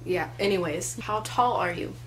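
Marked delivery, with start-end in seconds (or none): none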